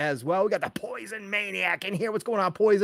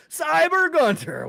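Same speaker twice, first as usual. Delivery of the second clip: high, loud, drawn-out name call; hyped shout-out; 11 semitones higher, 6 dB louder, slower than usual. Real, not acted. Host greeting a chat regular, hyped and drawn out. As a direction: announce the name like an arena entrance.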